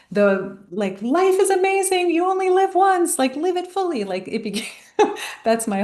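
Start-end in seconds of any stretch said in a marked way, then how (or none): 1.0-4.0 very high, louder ecstatic bird voice. Pretend. Rescued bird celebrating its new lease on life, high and ecstatic. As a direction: gush like a bird reborn. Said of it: "and is now like".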